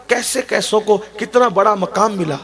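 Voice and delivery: ordinary talk, nothing marked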